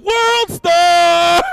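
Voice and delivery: loud mock street yell; hyped mock shout; yelling World Star. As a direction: holler it to the block. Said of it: "top of the street yelling".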